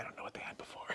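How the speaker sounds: barely audible whisper. Real, not acted. Co-host finishing a point after a mic check, whispered. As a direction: whisper it under your breath.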